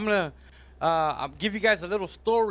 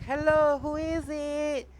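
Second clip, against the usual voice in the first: high, drawn-out dainty call; sing-song heart; 9 semitones higher, slower than usual. Acted. Heart calls out the window to a knocker, sing-song. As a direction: put on a high voice, stretching the question.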